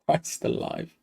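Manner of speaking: breathless whisper of shock; real stunned whisper; whispered disbelief. Real, not acted. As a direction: barely voice it, just air. Stunned by the goal.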